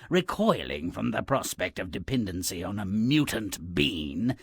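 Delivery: usual speaking voice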